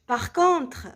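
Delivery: normal talking voice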